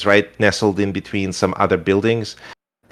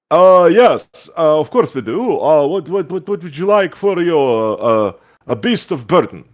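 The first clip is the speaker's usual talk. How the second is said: goo shopkeeper voice; 5 semitones higher than usual. Acted. Goo shopkeeper welcoming a lady to his store, high and showy. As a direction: sell it like a pompous jelly shopkeeper. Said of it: "He goes".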